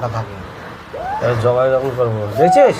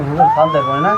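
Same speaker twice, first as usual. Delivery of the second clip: menacing shout. Acted. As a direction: bark it at the captive. Torturer yelling at victim.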